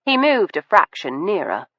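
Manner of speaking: ordinary delivery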